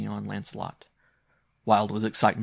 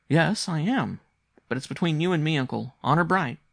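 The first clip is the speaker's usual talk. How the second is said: put-on high, louder, insistent child; earnest young boy; 7 semitones higher, 6 dB louder than usual. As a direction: press uncle for his promise. Boy swears uncle to secrecy.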